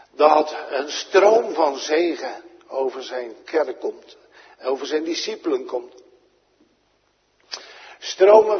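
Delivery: swelling pulpit fervour; full-force preaching. Acted. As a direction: lift it up and proclaim the abundance.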